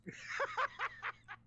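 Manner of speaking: high-pitched burst of laughter; amused laugh. Real, not acted. Host reacting to the soundboard drop, amused laugh.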